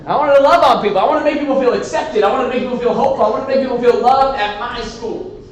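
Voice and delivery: high, loud, eager build; excited. Real, not acted. Excited about agape mission.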